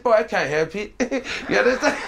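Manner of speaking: high, breaking into laughter; laughing. Real, not acted. Telling the podcast host about his accent, laughing through it.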